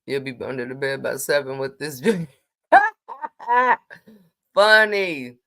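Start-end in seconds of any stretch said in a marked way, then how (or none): 2.7-3.9 loud laugh. Real, not acted. Laughing at a viewer's joke.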